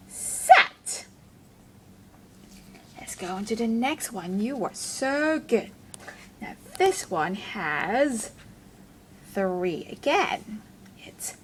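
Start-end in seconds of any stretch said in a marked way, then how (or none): 0.1-1.1 Sat popped out much higher, breathy and unvoiced; excited whisper reveal. Teacher reveals the word to children, excited and much higher. 11.0-11.4 whisper. Acted. Whispered aside.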